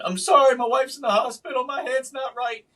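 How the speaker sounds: high-pitched re-enacted outburst, loud at first; retold screaming. Husband losing it at a store lady over his wife, retold screaming. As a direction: act out losing it at a stranger. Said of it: "And I'm screaming".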